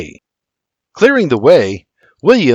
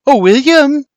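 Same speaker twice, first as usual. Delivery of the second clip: calling out; 13 semitones higher, 4 dB louder than usual. A narrator's reading. Sister calls William from the porch, calling out. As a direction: sing out the name to fetch someone. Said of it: "hears Flora calling from the porch".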